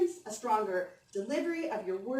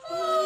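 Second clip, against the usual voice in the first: high, loud yawn on oh; 7 dB louder than usual. Shown as a demonstration. Coach shows students a big yawn, loud and high. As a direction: let the yawn ring out. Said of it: "We're gonna do some big yawns".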